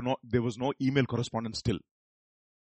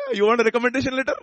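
mock pastor; 11 semitones higher, 11 dB louder, faster than usual. Pastor mocks a student's request.

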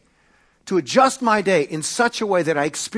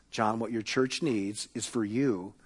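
softer, lower, calm and steady; 6 semitones lower, 9 dB quieter than usual. Retold or imitated. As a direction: gently settle an anxious pastor. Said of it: "Dallas very calmly says".

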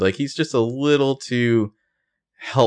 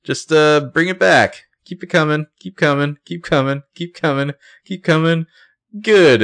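higher-pitched calls guiding a reversing driver; calling directions; 8 semitones higher, 4 dB louder than usual. Retold or imitated. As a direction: wave a car back with repeated, high calls. Guiding a car backing up.

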